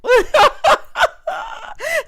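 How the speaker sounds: high, loud burst of laughter. Real, not acted. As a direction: let out a big shrieking laugh. Host cracks up at her pun.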